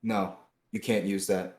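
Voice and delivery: usual speaking voice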